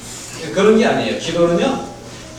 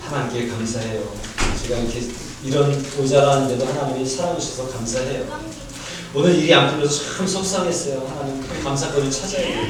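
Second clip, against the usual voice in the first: quiet, lower grateful prayer; thankful prayer; 5 semitones lower, 4 dB quieter than usual. Pretend. Pastor shows the thankful way to pray, soft and humble. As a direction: softly count your thanks to God. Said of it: "떼써서가 아니라".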